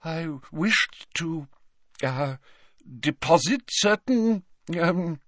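halting, high-pitched evasion; flustered professor covering up; defensive. A narrator's reading. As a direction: pick each word carefully, stalling. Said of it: "drawing her shawls around her defensively".

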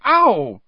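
high, loud yelp of pain; pained cry. Acted. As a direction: cry out as the boils erupt. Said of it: "tears starting in her eyes".

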